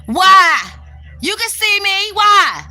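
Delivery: loud, high, fed-up shouting at the chat; exasperated yelling. Real, not acted. Streamer yelling at viewers who want the light on, loud and fed up. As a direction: snap at them, really annoyed.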